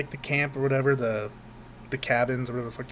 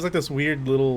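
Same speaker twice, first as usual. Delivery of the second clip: ordinary delivery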